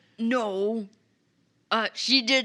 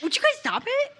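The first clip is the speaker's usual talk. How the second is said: mock protest; 3 dB louder, much faster than usual. Real, not acted. Player protesting the jokes.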